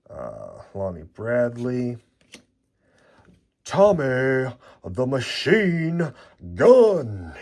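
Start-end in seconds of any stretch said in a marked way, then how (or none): 3.6-7.3 ring announcer. Pretend. Calling out a boxer's nickname.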